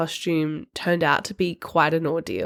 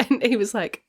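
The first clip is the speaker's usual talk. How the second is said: ordinary delivery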